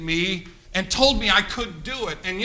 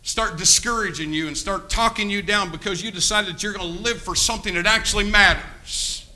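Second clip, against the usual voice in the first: normal talking voice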